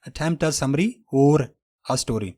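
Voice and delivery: very slow, "or" with r; slow American example. Shown as a demonstration. Teacher reads American example sentence slowly. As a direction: read it slowly in American style. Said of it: "American वाले पढ़ेंगे".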